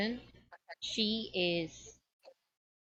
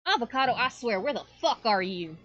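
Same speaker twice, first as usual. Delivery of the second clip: loud yell, starting higher and easing down; exasperated shout; 4 semitones higher, 5 dB louder than usual. Acted. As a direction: holler for a missing cousin, fed up. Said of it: "you just hear this big shout of".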